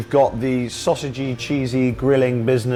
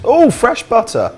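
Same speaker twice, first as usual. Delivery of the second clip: excited; 13 semitones higher, 7 dB louder than usual. Real, not acted. Delighted reaction to butter.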